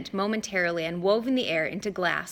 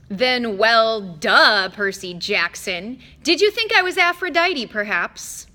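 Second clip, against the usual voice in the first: loud, sneering sarcasm; mock the boy; sarcastic; 2 semitones higher, 7 dB louder, slower than usual. A narrator's reading. Mister D sarcastic.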